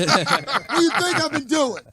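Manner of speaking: slow, high-pitched laughing exasperation; mock yell. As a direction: drag it out in disbelief, cracking up. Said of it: "and I just yelled it".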